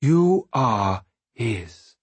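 very slow, weighted words; solemn reassurance. A narrator's reading. Prilkop tells Bee whose child she is, solemnly slow. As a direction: let each word stand alone, slowly.

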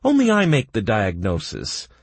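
mildly affronted; offended. A narrator's reading. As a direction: bristle and claim authority. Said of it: "Doc was offended".

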